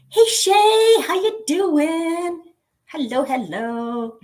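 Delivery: high, bright, drawn-out hello; excited greeting. Real, not acted. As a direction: sing out a welcome to a viewer. Greeting a viewer in the chat.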